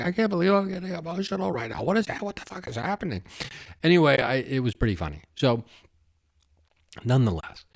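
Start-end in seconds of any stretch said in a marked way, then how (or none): 0.0-3.2 higher, put-on voice of shocked disbelief at your own tears; mock flustered disbelief. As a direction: play caught off guard by sudden emotion, half joking. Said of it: "So I'm just like".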